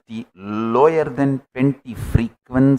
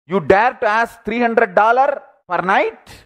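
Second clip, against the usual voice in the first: mock scream; 9 semitones higher than usual. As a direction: act out an outraged scream without full volume. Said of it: "The boy screams".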